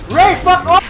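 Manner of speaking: high, loud yell; angry shout. Real, not acted. Player sick of Rey chasing him, angry shout. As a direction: yell it in exasperation.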